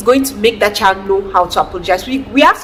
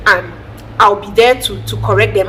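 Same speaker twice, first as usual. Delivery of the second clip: normal talking voice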